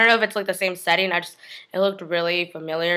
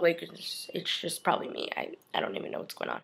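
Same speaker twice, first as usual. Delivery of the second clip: quick spooked whisper; nervous whisper; 2 semitones lower, 12 dB quieter than usual. Real, not acted. Vlogger spooked by noises, whispering fast. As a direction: whisper fast as if something's in the room.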